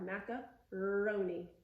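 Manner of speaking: slow "macaroni", broken before a long R; squeezed middle R. Shown as a demonstration. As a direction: hold the R in "macaroni" after a short gap. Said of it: "squeeze your tongue and lift it up".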